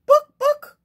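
very high, loud "Book, book!" clucks; clucking hen. Acted. Hen clucking at the puzzled librarian. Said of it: "clucked the hen".

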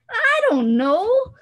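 very high, slow, drawn-out whine; embarrassed whine. Acted. Boy answers why one side is bigger, high drawn-out whine. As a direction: stretch it up high, flustered.

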